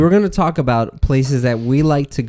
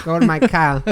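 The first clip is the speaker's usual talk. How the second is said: higher mock Boston accent, dropped R; Peter Griffin; 5 semitones higher than usual. Echoing a friend's cartoon impression, mock Boston accent. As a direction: echo it in cartoon New England twang. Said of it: "sound like Peter Griffin".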